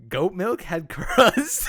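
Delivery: loud, high, drawn-out question; slow incredulous question breaking into laughter; amused disbelief. Real, not acted. Amazed by goat milk crust.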